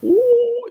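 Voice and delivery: shrill high karate yelp; high-pitched martial arts whoop; karate face yelp. Pretend. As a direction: let out a squealing kung fu cry. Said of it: "then you gotta make the karate face".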